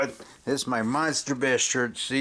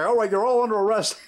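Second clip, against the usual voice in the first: put-on higher, louder kid-cop order; mock cop arrest; 8 semitones higher, 4 dB louder than usual. Boy playing Untouchables arrests the girls, higher mock cop voice. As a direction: bark it like a pint-sized detective. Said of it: "I say".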